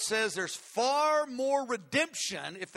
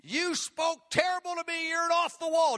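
higher and louder hammed-up blaming; mock accusation; 7 semitones higher, 5 dB louder than usual. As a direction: throw the charge at them like a fact. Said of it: "not a fact".

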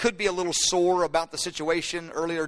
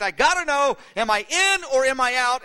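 higher-pitched, urgent plea to know; anxious demand; 6 semitones higher, 4 dB louder than usual. Acted. Believer asking God where they stand, anxiously demanding. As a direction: press urgently for an answer.

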